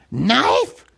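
Gollum's hesitant guess; tentative Gollum guess. A narrator's reading. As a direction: ask it like an unsure cave thing.

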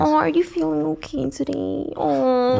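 mock-sweet, high-pitched coo; baby voice. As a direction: imitate a guy babying his girlfriend. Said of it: "when they talk in baby voice".

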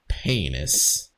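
one deep solemn word after a long pause. Retold or imitated. Host imitates a famous narrator gravely saying penis, deep and slow. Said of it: "[name] saying penis in his narrator voice".

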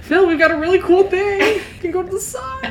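high, sing-out fake enthusiasm; mock-excited pitch. Luring stepdad onto a rocket, mock-excited. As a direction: sell a fake treat, very high.

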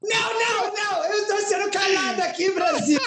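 grito agudo de falsa indignação; protesto escandaloso. Pretend. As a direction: proteste bem agudo, fazendo drama de brincadeira. Protesta por ter sido mutado. (esganiçando, em protesto teatral)

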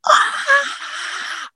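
high strained scream, held back in volume; re-enacted pushing cry. Mother pushes on doctor's orders, high strained cry.

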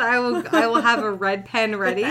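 laughing through the words. Real, not acted. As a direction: say it while laughing. Laughing threat.

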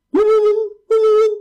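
high-pitched rapid bee buzzing; attacking bee buzz. A narrator's reading. Bee charging at the monster, high buzzing. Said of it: "它叮怪兽的身体".

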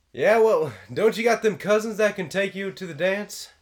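high, loud, blustery uncle; Uncle Rico voice. Acted. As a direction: bark it down like Uncle Rico from the house. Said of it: "my Uncle Rico voice is pretty good".

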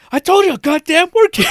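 very high, loud mock yell; retold scream. Agent screaming at her kids again, loud mock yell. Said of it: "She's screaming at somebody".

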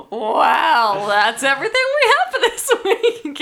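high and loud, laughing through; can barely get the words out. Real, not acted. Outro read through laughter.

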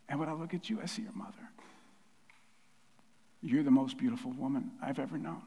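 soft, fading to a whisper; tearful tenderness. Acted. Dad telling daughter she resembles her mother, tenderly hushed. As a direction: let the words sink into a hushed whisper. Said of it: "with tears in his eyes".

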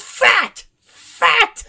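very high, loud squealing yells; shrieking. Retold or imitated. Accountant shrieking while wrestling over the money. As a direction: squeal it in a hysterical tussle. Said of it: "Leo just goes".

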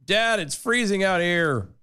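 lightly voiced complaining son; whiny kid. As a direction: whine it like a cold kid.

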